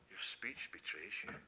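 low, soft, knowing accusation; quiet accusation. Retold or imitated. Servant girl quietly exposing Peter's accent. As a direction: say it softly like a sly accusation.

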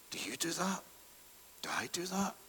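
whispered to himself; self-searching whisper. Real, not acted. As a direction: turn the question inward, barely voiced. Preacher asks himself.

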